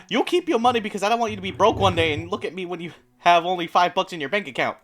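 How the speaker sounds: ordinary delivery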